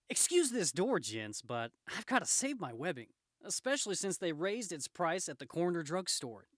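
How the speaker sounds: lightly voiced, lively hero; wisecracking Spider-Man. Spider-Man to gents under a smashed door, breezy. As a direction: toss it off as a breezy quip.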